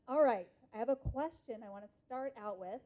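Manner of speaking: usual speaking voice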